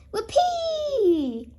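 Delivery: high, laughing whoopee; gleeful shout. Acted. Grandpa watches his plane zoom up: high laughing whoopee. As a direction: whoop with joy as it climbs. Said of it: "shouts Grandpa Pig".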